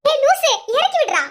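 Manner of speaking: high, loud startled cry; half-laughing protest, put me down; wife shrieking at husband who lifts her up. Acted. Wife protesting being lifted up.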